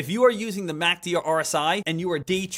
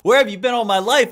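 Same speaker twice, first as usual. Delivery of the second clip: louder, delighted cry of relief; overjoyed gush; 3 semitones higher, 8 dB louder than usual. Real, not acted. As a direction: gush like you finally found the one. Gushing over the Dex tool.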